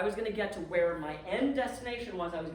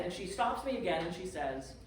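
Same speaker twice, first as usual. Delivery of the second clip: ordinary talk, nothing marked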